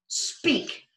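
sharp high command; ordering. Acted. Becky ordering the frog to speak, sharp command. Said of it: "she ordered".

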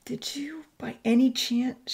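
high, hushed, tentative question; anxious. Acted. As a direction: ask anxiously, barely daring. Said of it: "she continued anxiously in Italian".

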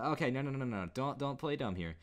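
ordinary delivery

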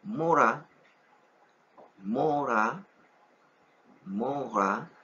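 "mora" with a tapped r, stress on the final a; Hebrew word morá. Shown as a demonstration. Teacher modeling Hebrew morá for students. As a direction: say "mora" slowly, light r.

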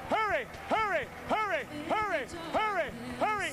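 high, urgent repeated hurry. Real, not acted. Preacher urging people to the altar, urgent and high. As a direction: press them forward urgently.

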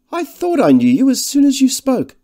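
very high, loud exclamation; effusive recognition. A narrator's reading. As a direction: gush at meeting an old schoolmate. Slender man greets old schoolmate.

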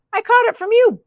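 high-pitched cow passing the blame; lightly buzzed accusation. A narrator's reading. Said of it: "buzzed the cow".

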